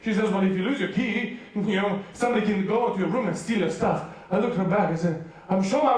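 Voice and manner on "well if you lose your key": usual speaking voice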